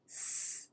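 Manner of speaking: "s" as a sustained voiceless hiss; unvoiced s. Shown as a demonstration. Teacher showing students the sound, unvoiced "s". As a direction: hold "s" with no vocal-cord vibration. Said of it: "it's unvoiced 'cause I don't feel".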